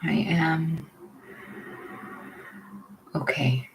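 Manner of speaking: unhurried, leave room for each breath; guided meditation. Real, not acted. Leading listeners into belly breathing, guided meditation.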